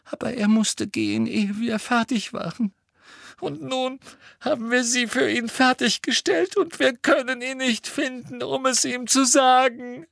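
a distressed schoolgirl; Hermine voice. A narrator's reading. (Hermine mit hoher, verzweifelter Stimme)

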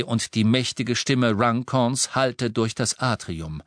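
ordinary delivery, nothing marked

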